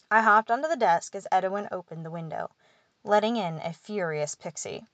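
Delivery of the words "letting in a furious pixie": ordinary delivery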